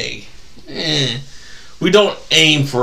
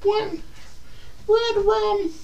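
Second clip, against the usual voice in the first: high, soft creepy little voice; hammed-up spooky falsetto; movie quote; 10 dB quieter, slower than usual. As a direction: croak it small and high, like an eerie chant. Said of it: "I'm busting out all the movie quotes".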